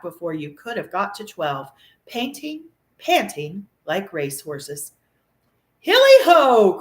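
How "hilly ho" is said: slow, much higher, louder whoop; hearty cry. A narrator's reading. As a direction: whoop for the party. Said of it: "cried old Fezziwig".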